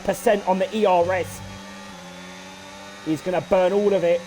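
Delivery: ordinary talk, nothing marked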